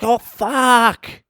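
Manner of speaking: high, loud whiny squeal. Retold or imitated. Beggar squealing at the offer of food, high and loud. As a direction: squeal it in put-on dismay.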